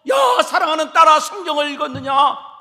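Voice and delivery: loud fond call, yah up front; calling to a daughter. Pretend. A parent calling loudly to a daughter about Bible reading. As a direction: shout it out lovingly. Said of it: "그렇게 물어보라는 거예요".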